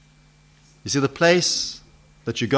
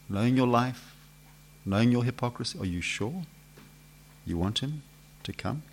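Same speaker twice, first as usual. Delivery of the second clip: much softer, probing question; hushed challenge; 9 dB quieter than usual. Real, not acted. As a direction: lean in and ask quietly, testing them. Searching question to congregation.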